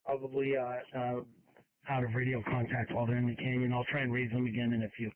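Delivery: crackly construction walkie talkie. Real, not acted. Scanner radio dispatch traffic.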